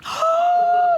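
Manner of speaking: loud, high theatrical gasp of shock; mock gasp. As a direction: gasp for the whole crowd to hear. Wife lied to husband.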